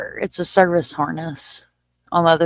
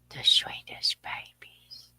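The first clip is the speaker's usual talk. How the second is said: whispered coo; 8 dB quieter, slower than usual. Real, not acted. Cooing at baby gator pictures in a slow whisper. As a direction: breathe it out like a lullaby.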